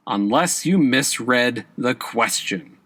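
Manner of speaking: usual speaking voice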